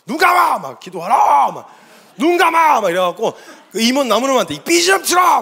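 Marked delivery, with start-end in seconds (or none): 0.1-0.6 high, loud mock-angry command. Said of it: "막 화난 것처럼 막". 0.8-1.5 loud mock-angry order to pray; feigned fury, shouted command. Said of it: "막 화난 것처럼 막". 2.2-2.8 high, loud mock-angry command. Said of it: "막 화난 것처럼 막". 4.5-5.4 high, shouted mock-angry order. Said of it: "막 이러면서 막 난리를 쳐놨어요".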